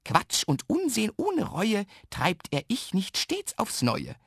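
high, slow showing off; boasting. A narrator's reading. Little man shows off his nonsense, boasting. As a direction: brag in a drawn-out singsong rhyme. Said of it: "prahlt der kleine Herr Ich nicht".

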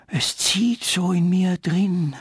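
high, slow rustling tree; a tree creaking its feelings out, high and slow. A narrator's reading. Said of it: "rauschte der Götterbaum".

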